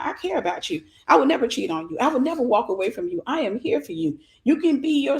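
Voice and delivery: ordinary talk, nothing marked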